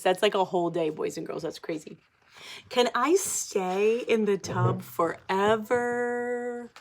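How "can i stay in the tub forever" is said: slow, drawn-out begging; pleading pigeon. Acted. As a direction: stretch it out, begging sweetly.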